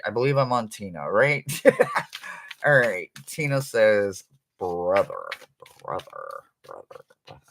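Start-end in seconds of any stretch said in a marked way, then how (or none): 4.6-7.4 repeated word fading to near silence; fading echo. Retold or imitated. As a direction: let each repeat sink lower and softer. Relays a word received, fading away.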